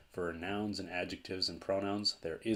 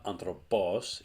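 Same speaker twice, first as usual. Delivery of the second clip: "anthropos" stressed wrongly on final "pos"; stress on last syllable; 4 dB louder, slower than usual. Shown as a demonstration. Teacher showing another wrong stress, "anthropos" stressed on its last syllable. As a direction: land hard on the "pos" of "anthropos". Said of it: "it's not anthropos".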